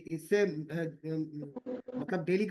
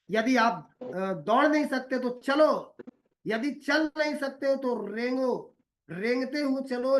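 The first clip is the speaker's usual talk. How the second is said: rising rousing quote; 5 semitones higher, 4 dB louder than usual. Retold or imitated. Rousing Martin Luther quote.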